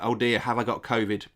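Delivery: usual speaking voice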